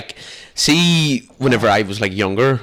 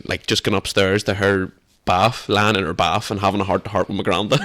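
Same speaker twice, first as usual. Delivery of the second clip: ordinary talk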